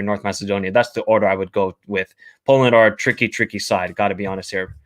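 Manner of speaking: normal talking voice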